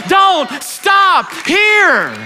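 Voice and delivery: very slow, high, shouted; stretched preacher's shout; shouted exhortation. Acted. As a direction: drag each word out in a full cry. Climax of sermon exhortation.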